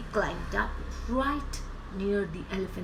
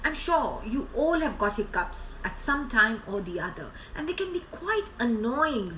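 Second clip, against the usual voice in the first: ordinary delivery, nothing marked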